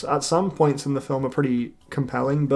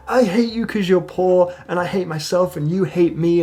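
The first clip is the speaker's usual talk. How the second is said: higher, louder, hammed-up hateful rant; mock angry outburst; 4 semitones higher, 4 dB louder than usual. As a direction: spit it at him like a half-acted tantrum. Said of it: "she's like".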